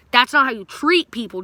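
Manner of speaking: neighbor's mom scolding the narrator; retold scolding. Mom scolding while walking inside.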